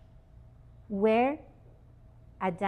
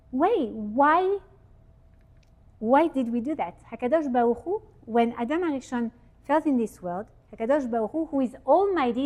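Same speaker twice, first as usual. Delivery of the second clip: usual speaking voice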